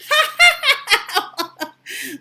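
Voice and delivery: loud squealy pig laughter; high mocking laugh. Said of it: "laughed the little pigs again".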